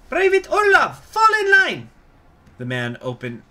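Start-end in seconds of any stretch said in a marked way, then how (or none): 0.1-1.9 very high, loud barked command; squeaky shouted order. Acted. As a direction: snap the order down the line. Marshal orders private.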